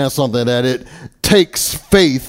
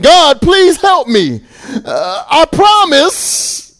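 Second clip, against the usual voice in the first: high, loud, slow pleading cry; desperate man crying out to God; 5 dB louder, slower than usual. Acted. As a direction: beg God from the branch. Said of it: "Jack cries out in excitement".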